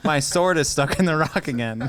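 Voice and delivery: mock-acting a silly parody clip for a laugh; hammed-up porn scene voice. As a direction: overplay it like a cheesy parody clip.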